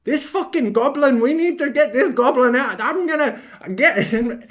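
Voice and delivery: high-pitched ranting impression; badly faked worked-up pundit rant; MMA Guru. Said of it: "in his best M M A guru impression ever".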